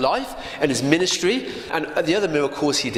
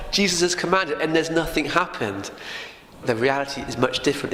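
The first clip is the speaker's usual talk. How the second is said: ordinary talk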